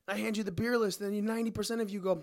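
fed-up bartender venting; ranting. Real, not acted. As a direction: vent at the customers. Rant continues.

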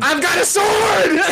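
high, drawn-out battle cry; very enthusiastic. Acted. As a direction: shout it slowly like a hero charging in. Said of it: "that was very enthusiastic".

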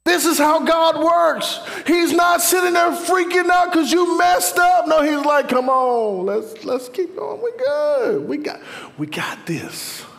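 high, slow, shouted preacher cry; full-force preaching. Real, not acted. Preacher tells the congregation how God works, crying out passionately. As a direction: cry it out high, easing slightly toward the end.

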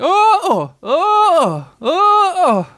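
high, loud "Oh" swooping up and down; squealed ohs on every jump; bouncing ohs. A narrator's reading. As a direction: swing each "oh" up and down like being bounced. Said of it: "he was saying".

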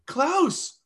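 high, loud call down the aisle; calling out names in surprise. Retold or imitated. Woman calling them across aisle.

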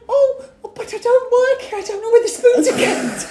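high-pitched helpless imitation; mock someone out of their depth, pitched high; flustered helper. Retelling her excuse about the spoons, high mock fluster.

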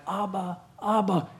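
put-on soft child calling Abba; lightly voiced little kid calling Abba; child calling dad. Jerusalem kids calling their father, soft child voice. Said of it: "the little kids were calling their dad".

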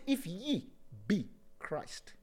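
very soft, slow intimate line; soft meditative prompt; hushed verse. Acted. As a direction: breathe the verse out.

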